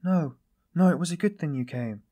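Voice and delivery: high, loud opening protest; emphatic cry. A narrator's reading. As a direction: cry out in urgent reassurance. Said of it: "cried Sonia".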